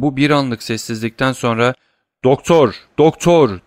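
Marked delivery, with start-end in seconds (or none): 2.2-3.6 loud desperate cry; shouting. Acted. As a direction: yell for the doctor down the hall. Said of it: "diye haykırdı". (kapıya atılan Villefort, yüksek sesle haykırış)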